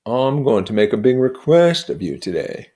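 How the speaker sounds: lightly voiced proud storyteller, rising; boasting of medals, building up; Gadsby telling his war tale. Gadsby boasting to Nick of his war medals, proud.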